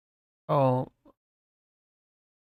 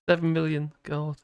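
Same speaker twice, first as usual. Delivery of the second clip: ordinary talk, nothing marked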